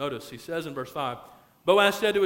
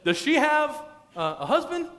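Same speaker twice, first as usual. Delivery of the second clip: high, loud fishing question; acted keen interest, rising high; eager curiosity; 10 semitones higher, 4 dB louder than usual. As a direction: pitch it way up, angling to know. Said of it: "He says".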